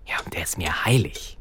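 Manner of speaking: whispering. Real, not acted. Whispered aside.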